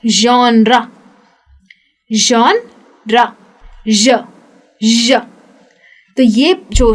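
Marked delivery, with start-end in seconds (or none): 0.0-3.3 जॉनरा drawn out slowly, zh at the start; slow zh demo. Shown as a demonstration. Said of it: "कुछ ऐसा ही आएगा".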